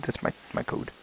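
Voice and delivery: very soft whisper to yourself; whispered aside. Real, not acted. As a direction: say it under your breath. Aside about code.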